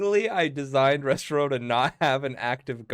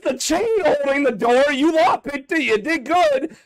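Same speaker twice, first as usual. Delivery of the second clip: loud, high-pitched protest; exasperated shouting; 11 dB louder than usual. Real, not acted. Game master argues over a nat twenty and the chain, exasperated shouting. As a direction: shout back in comic exasperation.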